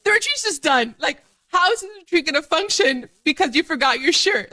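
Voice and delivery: high, exasperated mock despair over a tiny mishap; mock panic. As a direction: act out your own silly meltdown, pitched high. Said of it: "I was like".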